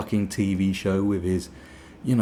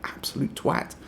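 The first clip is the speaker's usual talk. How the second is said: put-on higher, indignant child voice; mock kid; 11 semitones higher, 3 dB louder than usual. Kid telling mum the toy is fake. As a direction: complain like an outraged kid. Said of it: "kid answers mum".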